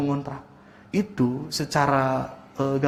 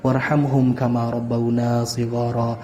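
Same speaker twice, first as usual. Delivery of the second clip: quick, low, monotone recited dua; low formal Arabic recitation; closing Arabic prayer; 5 semitones lower, much faster than usual. Real, not acted. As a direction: recite the prayer in a steady, rapid chant.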